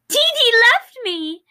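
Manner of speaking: high, loud, slow wail of heartbreak; crying out. Acted. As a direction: wail it as the tears start. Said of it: "he cried out as he let the tears".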